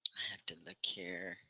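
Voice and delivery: a real whisper to herself; soft aside whispered under the breath. Real, not acted. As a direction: whisper it off-mic, checking the screen. Checking screen quietly while live.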